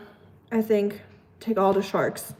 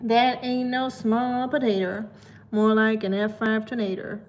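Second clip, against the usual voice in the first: slow Southern twang, a frog in the throat; southern drawl. Shown as a demonstration. To chat, showing how to sound southern with a slow Southern drawl. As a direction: drawl it like a Tennessee good ol' boy. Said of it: "frog in your throat if you wanna sound southern".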